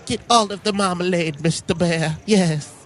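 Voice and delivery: lightly voiced sweet granny; mild doting old woman; old lady voice. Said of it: "I'm just like".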